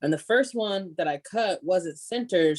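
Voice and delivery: ordinary delivery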